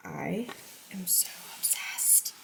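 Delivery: breathy delighted whisper. Real, not acted. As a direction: whisper it adoringly. Showing pattern up close.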